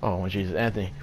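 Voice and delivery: normal talking voice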